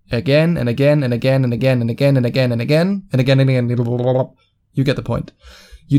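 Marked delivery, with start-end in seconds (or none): 0.1-4.3 rapid repetition. Shown as a demonstration. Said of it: "repeating for emphasis".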